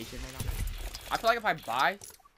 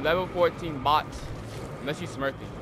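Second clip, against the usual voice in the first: ordinary delivery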